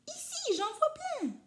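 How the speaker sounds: very high squeaky bird voice; exclaiming bird. A narrator's reading. Majou the bird exclaiming at the newspaper, very high. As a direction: chirp it out with delight.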